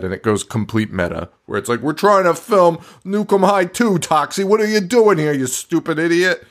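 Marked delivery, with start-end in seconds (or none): 1.8-6.4 raised pitch, acted-out irritation; mock exasperated scolding. Film crew to Toxie intruding on set, mock exasperation.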